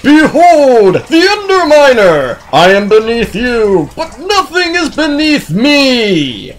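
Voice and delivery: loud, high, hammed-up villain proclamation; Underminer. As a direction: declare your villainy in long, booming, theatrical words. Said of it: "What I heard is".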